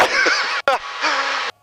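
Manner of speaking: delighted squealing laugh; high giggling laugh of thrill. Real, not acted. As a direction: burst out laughing in disbelief. Thrilled laugh after climb claim.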